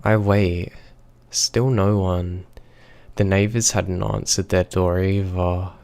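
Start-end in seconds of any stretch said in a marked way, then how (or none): none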